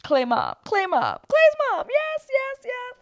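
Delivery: loud, high squealed chant; hyped cheering. Real, not acted. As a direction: cheer her on like a superfan. Cheering for Clay's mom.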